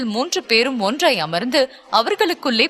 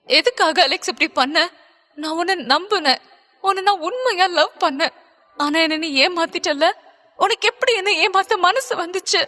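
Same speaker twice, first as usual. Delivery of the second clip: higher, hurt heroine close to tears; a voice cracking with betrayal; broken voice; 5 semitones higher than usual. A narrator's reading. Stella asking Alex how he could deceive her, voice breaking. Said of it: "உடைந்த குரலில் Stella கேட்க".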